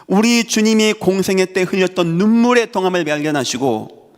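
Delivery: high, rising preacher's blessing; fervent preaching. Acted. Preacher blessing his congregation, fervent. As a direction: lift the congregation with a rising call.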